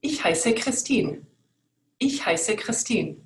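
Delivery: slow German with the tongue behind the teeth; teeth closed. Shown as a demonstration. Teacher showing German with teeth closed, slow and clear. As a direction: say Ich heiße Christine slowly, teeth together. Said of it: "here you're gonna see my teeth".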